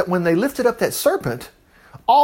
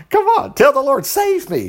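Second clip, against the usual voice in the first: high, pleading urge; excited urging; 10 semitones higher than usual. Real, not acted. Preacher urging listeners to cry save me, high. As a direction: call it out high and eager.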